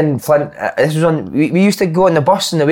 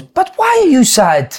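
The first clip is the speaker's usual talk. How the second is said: slow, much higher Italian manager voice; Italian accent; 12 semitones higher, much slower than usual. Retold or imitated. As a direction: ask it like a baffled Italian manager. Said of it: "Then he goes".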